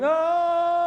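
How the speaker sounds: long high falling scream. Acted. Man blown off a cliff, long high scream.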